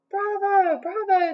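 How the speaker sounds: high-pitched cheer of applause; high, celebratory cheer. A narrator's reading. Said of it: "they cheered".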